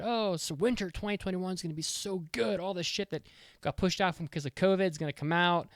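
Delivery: mildly hammed-up anticipation; imitated hype. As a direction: replay your own earlier gushing. Quotes the hosts' earlier hype.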